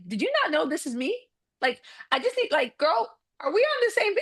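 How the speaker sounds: put-on high, rising, fast girl-are-you-serious tone; mock incredulous. Coach confronting the mom in a DM, high incredulous teasing. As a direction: toss it at her like you can't believe it.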